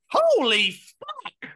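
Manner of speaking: high, loud, slow drawn-out mock shock; shocked yell. Imagining meeting aliens, a loud, slow mock-shocked yell. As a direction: act out seeing an alien for the first time, hammed up. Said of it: "It would either be".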